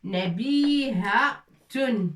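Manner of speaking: nabihatun drawn out very slowly, syllable by syllable; slow spelling out. Shown as a demonstration. Teacher spells a word out slowly for the class. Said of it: "teacher spells out the word".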